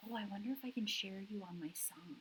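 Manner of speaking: soft whisper to herself; whispered aside. Real, not acted. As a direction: whisper it, thinking aloud.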